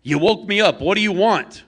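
high, loud, furious yell; angry shout. Acted. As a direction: snap at the stranger on the porch. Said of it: "angrily says".

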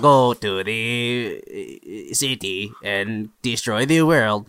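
put-on slow, stiff French robot accent; bad French robot accent. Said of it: "That's a horrible French robot accent".

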